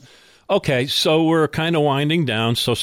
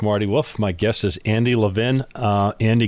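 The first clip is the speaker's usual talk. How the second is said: normal talking voice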